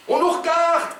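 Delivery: very high-pitched alarmed cry. A narrator's reading. Oumar bangs on the car window, crying out. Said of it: "crie-t-il en frappant du poing".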